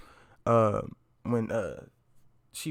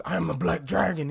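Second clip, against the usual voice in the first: higher-pitched mock-furious outburst; imitated fury; 5 semitones higher than usual. Imitating Kano getting mad in a funny movie scene, furious. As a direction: act out a worked-up fighter's angry boast. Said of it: "He was getting furious".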